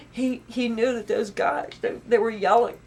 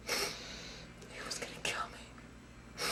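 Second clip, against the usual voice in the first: slow, hushed whisper, the horror sinking in; 12 dB quieter, much slower than usual. Real, not acted. To the interviewer, reliving the terror: slow whisper. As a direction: let it fall to a slow whisper.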